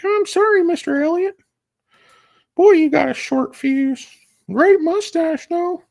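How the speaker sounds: put-on cheeky kid squeaking back at a cowboy; high kid voice sassing the cowboy; squeaky child voice. Child answering Sam Elliott.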